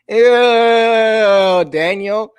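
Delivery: disgusted. Acted. Snot on Daniel's face.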